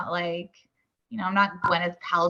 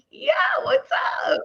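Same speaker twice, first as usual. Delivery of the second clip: very high excited shout; excited squeal; 3 dB louder than usual. Retold or imitated. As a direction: squeal it out, thrilled. Fan recognizes her.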